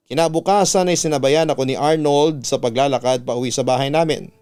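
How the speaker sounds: plain reading voice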